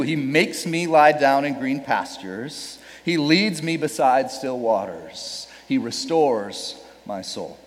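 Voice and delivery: slow, measured reading; slow solemn reading; slow scripture reading. Shown as a demonstration. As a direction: read the verse slowly.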